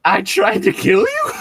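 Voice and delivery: can't believe it; disbelief. Acted. Disbelieving reaction read aloud.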